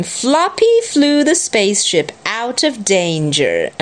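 ordinary delivery, nothing marked

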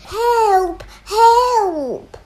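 high, loud repeated cries; acted shouts for help. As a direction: yell for rescue as children in a story. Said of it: "they all shouted".

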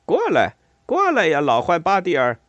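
loud, high shout to a friend; high hailing call. A narrator's reading. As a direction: holler him over, pitched well up. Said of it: "水鼠莱特喊道".

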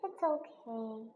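very soft, slow, downcast; sad. A narrator's reading. Teddy B answering Doc's apology, sad. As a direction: say it small and sadly.

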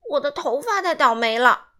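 whining loudly about his bad day; loud wail. A narrator's reading. Simon complaining to grandma. (西蒙向奶奶大声哭诉)

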